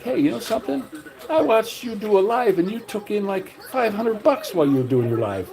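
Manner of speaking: high, heated comeback to a critic; retold indignant rebuke. Answering a critic who called him a beggar, indignant and high. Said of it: "And I'm like".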